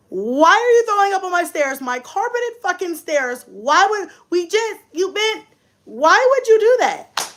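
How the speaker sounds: high, whiny exasperated scolding; hammed-up indignant outburst; mad. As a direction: plead in disbelief, pitch way up. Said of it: "Now I'm mad".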